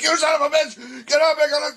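high, loud mock shout; mock drill-sergeant wake-up yell. Dad imagining waking his kid tomorrow morning, mock angry shout. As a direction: burst in yelling, hammed-up fury. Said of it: "walk into a room tomorrow morning and be like".

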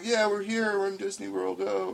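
high, eager tourist joy; bubbling over on arrival at Disney; excited. Retold or imitated. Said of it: "So I'm very optimistic".

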